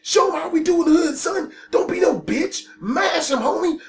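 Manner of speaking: high-pitched hype-man goading; egging him on. Retold or imitated. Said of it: "Brad and his asshole friend are like".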